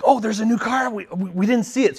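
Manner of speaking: loud, high-pitched burst of retold surprise; astonished kid reaction, played up for laughs; surprised kids. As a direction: act out kids caught off guard by big news. Kids surprised by news of car.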